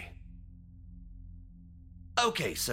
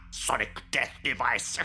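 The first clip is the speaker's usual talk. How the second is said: ordinary talk, nothing marked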